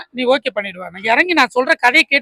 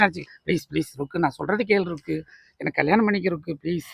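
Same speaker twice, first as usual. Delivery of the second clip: soft begging; 9 semitones lower, 12 dB quieter than usual. Acted. Begging Rukku to marry him.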